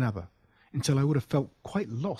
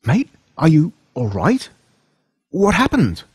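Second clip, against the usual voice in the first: high, loud and alarmed; worried; 6 semitones higher, 10 dB louder than usual. Acted. Boy checking on his hurt friend, alarmed and loud. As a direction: rush the words out in worry.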